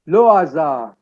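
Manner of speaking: "le hasard" with silent H and silent D; French-style "le hasard", no H, no final D; French pronunciation. Shown as a demonstration. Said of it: "The H is not, and D is not pronounced".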